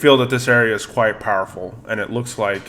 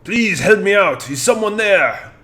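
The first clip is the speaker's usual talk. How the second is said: put-on higher French-accented plea for help; french chef; 8 semitones higher than usual. Trapped candelabra calls for help.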